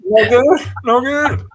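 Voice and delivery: high, loud mock Australian; hammed-up, high-pitched Aussie attempt; bad Aussie accent. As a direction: overdo a comic Aussie accent. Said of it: "trying to do an Aussie accent".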